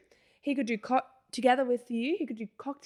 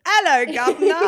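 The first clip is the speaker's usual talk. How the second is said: mock squeaky "Hello, governor" pitched up and louder; mimicking his high jump; 6 semitones higher, 13 dB louder than usual. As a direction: jump the voice up high and loud, imitating him. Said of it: "his voice was down here, and then it was".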